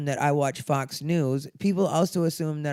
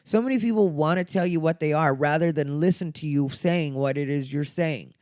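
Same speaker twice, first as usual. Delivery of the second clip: normal talking voice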